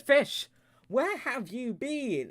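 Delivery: very high, slow, drawn-out plea; anxious calling out. Acted. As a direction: cry out to a long-lost pet. Reunited with lost fish.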